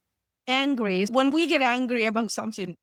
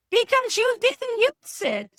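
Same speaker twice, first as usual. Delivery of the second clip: high, slow, drawn-out scold; exasperated scolding; 12 semitones higher, 4 dB louder than usual. Retold or imitated. Therapist scolding a client who skipped homework, exasperated and high. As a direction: spell it out like talking to a stubborn kid.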